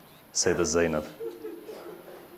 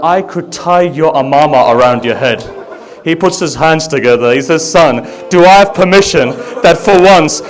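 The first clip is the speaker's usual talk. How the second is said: full-voiced grieving chant, louder and higher; loud lament recitation; 10 semitones higher, 12 dB louder than usual. Reciter mourning Ali Akbar's farewell to the gathering, loud wailing lament. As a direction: cry the story out to the mourners.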